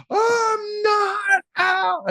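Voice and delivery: very high, long mock wail; hammed-up shrieking sob; imitated sobbing child. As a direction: drag it out as a bawling kid's protest. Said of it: "he's, like, sobbing to bed".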